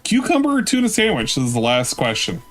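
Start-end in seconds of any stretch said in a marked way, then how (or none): 0.0-1.3 mock high voice. Mocking question in high voice.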